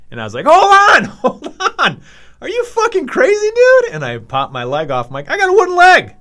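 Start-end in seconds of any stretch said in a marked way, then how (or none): 0.5-3.9 very high, loud yell to halt; frantic shout, acted. Storyteller stopping rival stapling himself, acted frantic yelling. 5.3-6.1 high, loud exclamation; shouted reveal, acted. Storyteller popping his leg off to rival, high shouted reveal.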